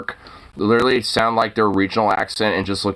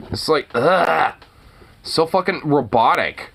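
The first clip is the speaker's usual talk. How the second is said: ordinary delivery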